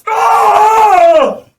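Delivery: put-on very high, loud shrieking cry of a three-year-old; toddler's pained cry. Imitating himself bitten at Sunday school, a high shrieking wail. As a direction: let out a full kid's wail as the fangs sink in. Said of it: "I guarantee you this is exactly what I sounded like".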